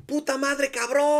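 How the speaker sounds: put-on high little-boy voice cursing gleefully; kid cussing. Son on the roller coaster, high kid cursing. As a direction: squeak out the curse like an excited kid. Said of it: "his little voice".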